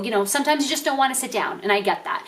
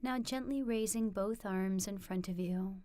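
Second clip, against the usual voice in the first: much softer, slightly lower meditation voice; guided meditation; 4 semitones lower, 16 dB quieter than usual. Acted. Teacher leading viewers into a standing mindful movement practice, soft guided meditation. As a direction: guide them gently, hushed, with long pauses between phrases.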